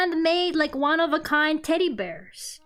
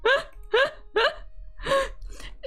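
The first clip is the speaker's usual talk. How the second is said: long high laugh; 12 semitones higher than usual. Real, not acted. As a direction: giggle on and on, pitched up.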